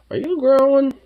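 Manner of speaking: pet voice. Real, not acted. High squeal at pet.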